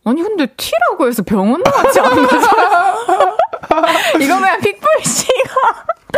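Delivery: laughing protest. Real, not acted. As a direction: protest while laughing hard. Breaking role, laughing protest.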